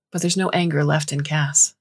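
ordinary delivery, nothing marked

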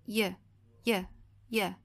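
"Y" spoken as a short "yuh" sound; isolated Y sound. Shown as a demonstration. Teacher points to letter sounds video, "yuh" for "Y". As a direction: repeat "Y" as a clipped-off glide, "yuh", not its name. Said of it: "ela tem o som".

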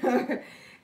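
loud burst of real laughter. Real, not acted. Reacting to a viewer's story, a loud real laugh.

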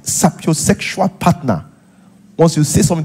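normal talking voice

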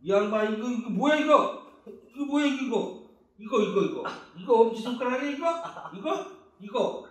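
hammed-up high-pitched fussing; acted bewilderment. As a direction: play it up as a flustered distraction. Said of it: "이거 연기를 잘해야 돼".

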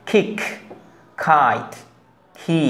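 খিক, খাইট with a strong puff on k; k breathed out like খ; aspirated k. Shown as a demonstration. Teacher showing the aspirated English k. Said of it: "কি করলাম আমরা? কেটাকে খ".

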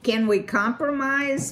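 high, loud pleading question. A narrator's reading. Valerian begs the beaver for a compromise, pleading cry. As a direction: beg him to meet halfway. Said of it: "Valerian pleaded".

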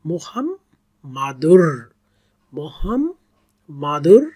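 মুহাম্মাদুর drawn out, flowing into র; tajweed demo of মুহাম্মাদুর; slow recitation. Shown as a demonstration. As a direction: chant মুহাম্মাদুর slowly. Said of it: "র আছে".

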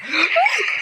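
high-pitched, rising gasp; startled gasp. Real, not acted. Gamer startled while chatting to viewers, startled gasp. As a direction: suck in air sharply, high. Startled mid-sentence in horror game.

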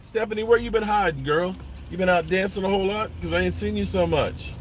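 light, quick teasing banter; playful teasing. Real, not acted. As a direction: tease a friend playfully. Teasing viewer, high and fast.

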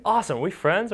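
put-on high, loud burst of kid excitement; excited. New kid to new best friend, a high, loud excited question. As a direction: pitch it up like a thrilled kid. Kids bonding, excited quote.

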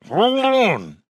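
high, drawn-out animal roar; werewolf growl. A narrator's reading. Werewolf Rob pleads with cops carrying nets, in a high roar. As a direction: howl it through a wolf's throat. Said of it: "Rob said".

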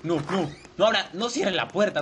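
ordinary delivery

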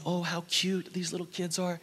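soft, hushed group admiration, retold; hushed cooing. Visitors seeing the sleeping orphans, hushed cooing. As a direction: coo quietly over sleeping children.

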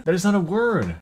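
incredulous. Real, not acted. Player mocking their own made-up guess in disbelief. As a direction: scoff at your own nonsense guess.

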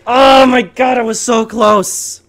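high wail, slowing at the end; real crushed outcry; anguished frustration. Real, not acted. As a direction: lament a narrow loss, drawn out. Just lost by one kill.

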